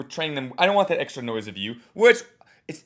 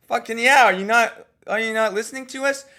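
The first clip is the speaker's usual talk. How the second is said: higher, louder fed-up crowd; mock exasperated; 8 semitones higher, 5 dB louder than usual. Coach voicing the annoyed audience, mock exasperation. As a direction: snap back like an annoyed crowd.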